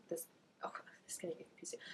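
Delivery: a breathy private aside; hushed real whisper. Real, not acted. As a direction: whisper it, half to yourself. Aside about two Joes.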